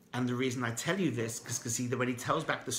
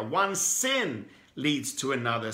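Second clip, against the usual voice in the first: ordinary delivery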